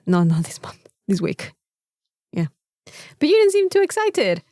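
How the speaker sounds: ordinary talk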